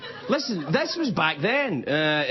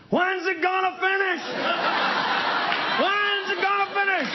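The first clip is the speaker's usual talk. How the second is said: put-on old folks heckling; 14 semitones higher than usual. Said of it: "the same voice goes".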